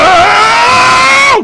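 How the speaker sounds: long high-pitched full scream; piercing, clear wordless scream. A narrator's reading. DiCaprio cocking his head towards Hill, screaming. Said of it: "manages to scream with complete clarity".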